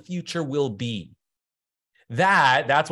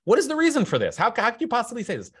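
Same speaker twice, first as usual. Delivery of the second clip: mock incredulous question; 8 semitones higher, faster than usual. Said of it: "here's what they say".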